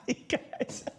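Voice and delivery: breathed through a laugh; real fond laughter. Real, not acted. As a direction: laugh the words out airily. Laughing at the chat.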